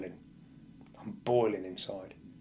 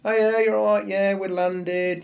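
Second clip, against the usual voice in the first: much higher, louder, slow cheery mum on the phone; his mum; 10 semitones higher, 11 dB louder, slower than usual. Retold or imitated. Mum calling her son after landing, higher and louder cheery. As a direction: play mum ringing from the port. Voicing his mum's usual call.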